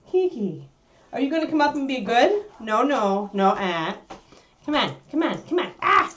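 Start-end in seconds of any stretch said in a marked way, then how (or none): none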